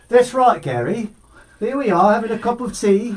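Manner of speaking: put-on a playful English accent for a fan's request. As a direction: put on a mock posh English voice over tea. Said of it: "English accent of Team Brian".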